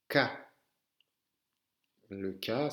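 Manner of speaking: usual speaking voice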